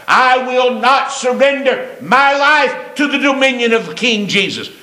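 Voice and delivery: high-pitched, declaimed defiance; defiant refusal. Acted. Preacher voices a defiant unbeliever.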